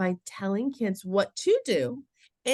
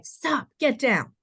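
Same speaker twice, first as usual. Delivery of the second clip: firm, higher-pitched warning; urgent command; 6 semitones higher, slower than usual. Shown as a demonstration. Adult halting a child in an emergency, urgent command. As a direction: give a firm, drawn-out order.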